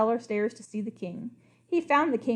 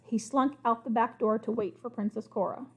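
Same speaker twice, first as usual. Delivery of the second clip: usual speaking voice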